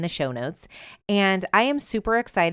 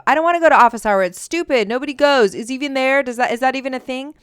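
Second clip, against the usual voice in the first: put-on higher, louder complaining kid; mock whiny son; 8 semitones higher, 8 dB louder, faster than usual. Mother imitates her son refusing office hours, higher and louder. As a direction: whine it like a teen who won't go.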